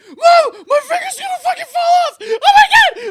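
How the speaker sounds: very high, loud panicked scream; full-blown panic. Retold or imitated. Kid with trapped finger on the freeway, panicked screaming. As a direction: shriek for Mom in terror, hammed-up. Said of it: "full-blown panic".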